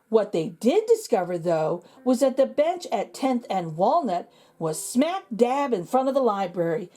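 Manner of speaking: ordinary delivery, nothing marked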